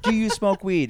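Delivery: high-pitched mock yell; half-shouted accusing question; retold screaming. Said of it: "I was screaming in the car".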